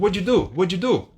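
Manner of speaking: "What you do?" run together as "wuddidu"; linked American English. Shown as a demonstration. Everyone in the States saying it, quick and merged. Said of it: "todo mundo tá falando".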